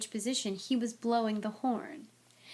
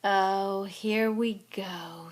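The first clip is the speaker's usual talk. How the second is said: bitter; much slower than usual. A narrator's reading. Nick hearing the rival hunt arrive, bitter. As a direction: drag it out with weary bitterness. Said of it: "said Nearly Headless Nick bitterly".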